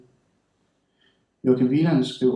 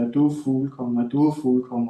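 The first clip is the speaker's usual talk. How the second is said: plain reading voice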